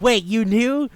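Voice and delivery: high, loud shocked question; can't believe what he just heard; stunned disbelief. Retold or imitated. Lion learns his friend knew all along, loud high disbelief.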